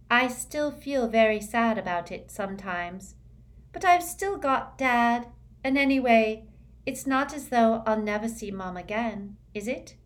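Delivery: airy, high and dreamy schoolgirl; dreamy girl voice. A narrator's reading. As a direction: float the words, lightly otherworldly.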